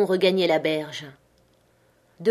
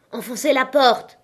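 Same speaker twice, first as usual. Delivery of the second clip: higher-pitched cry of command; shouted order; 5 semitones higher than usual. A narrator's reading. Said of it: "cria-t-il".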